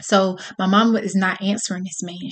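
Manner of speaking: ordinary delivery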